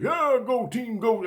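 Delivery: high, chirpy go-team cheer; mock cheery sports kids. Campers cheering at him, in a high chirpy mock cheer. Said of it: "They're all like".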